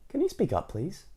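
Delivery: high, soft mock request; pretending not to hear. A narrator's reading. Missus Crumb asking Mister Noisy to speak up, feigned deafness. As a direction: ask sweetly high, as if deaf to it. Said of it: "pretending not to hear".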